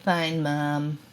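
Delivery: put-on dismissive teen muttering at mom; sulky teen mumble. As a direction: say it like a sullen kid brushing off mom. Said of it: "Sullen teen's response".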